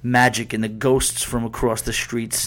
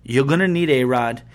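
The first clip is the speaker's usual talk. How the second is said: higher and louder, still heated; getting mad; 5 semitones higher, 6 dB louder than usual. Real, not acted. Host scolding Yankees fans, heated and loud. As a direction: keep the real anger up after the apology. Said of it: "I'm sorry, I'm getting mad".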